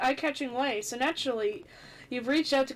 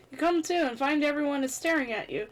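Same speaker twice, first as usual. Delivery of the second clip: ordinary talk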